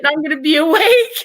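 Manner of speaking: half-laughing, half-panicked; laughing through it. Real, not acted. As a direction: laugh out the last word in mock panic.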